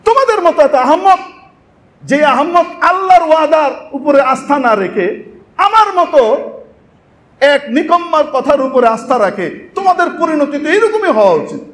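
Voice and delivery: mock the fools who trusted him; scornful Iblis. Iblis scorns the damned souls.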